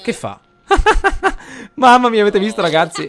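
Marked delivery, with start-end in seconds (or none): 0.7-1.7 high, loud real cackle; burst of laughter. Real, not acted. Gamer cracking up at his Sims' quarrel, loud laugh. 1.7-3.1 high, loud words through laughter; laughing. Real, not acted. Gamer laughing to his viewers about the fight, laughing.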